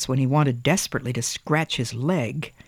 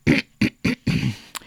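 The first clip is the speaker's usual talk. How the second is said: a small nervous throat clear; 2 semitones lower than usual. A narrator's reading. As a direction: clear the throat before speaking. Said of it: "Thomas cleared his throat".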